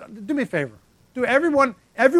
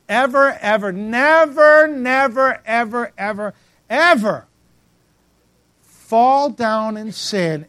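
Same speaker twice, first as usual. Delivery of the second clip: loud, high, stretched ever and never; preacher shouting never ever ever at the pews; shouted never ever; 3 semitones higher, 6 dB louder, slower than usual. Acted. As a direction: hammer each ever home.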